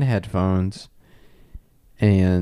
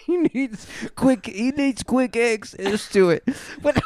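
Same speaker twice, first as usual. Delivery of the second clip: put-on scared kid, laughing; 9 semitones higher than usual. Continuing kid voice.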